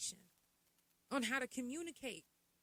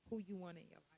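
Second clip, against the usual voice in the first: ordinary talk, nothing marked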